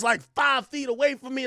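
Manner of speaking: ordinary talk, nothing marked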